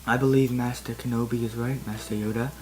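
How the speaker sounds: steady, quiet reasoning; calm voice. Acted. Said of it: "in a calm voice".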